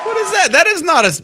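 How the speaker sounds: high-pitched what-is-that disbelief; incredulous. Real, not acted. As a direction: react in shocked disbelief. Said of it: "incredulous reaction".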